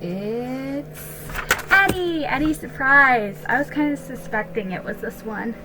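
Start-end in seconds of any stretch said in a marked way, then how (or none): 0.0-3.4 slow, high, delighted announcement of the title; drawn out with real excitement as the book is recognized; excited reveal. Real, not acted. Unwrapping gift, recognizing the book.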